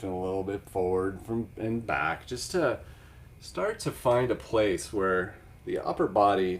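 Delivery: soft, calm yoga class guidance; yoga teacher voice. Acted. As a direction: gently guide the class through breathing.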